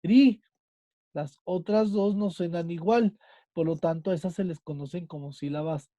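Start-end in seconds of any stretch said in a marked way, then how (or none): 0.0-0.4 "ri" punched out alone, higher and louder; "ri" said as the strong syllable of María; stressed syllable. Shown as a demonstration. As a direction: isolate "ri" and hit it hard. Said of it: "la que suena más es".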